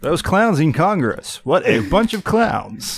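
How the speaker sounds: slow, punchy talk radio host. Pretend. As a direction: announce it like a radio pundit. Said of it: "I hate your radio voice".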